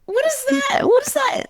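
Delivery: very high mock-baffled squeal; baffled squeak. Mimicking people puzzled by barn work, a high baffled squeal. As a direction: squeak it like the idea makes no sense. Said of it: "I was like".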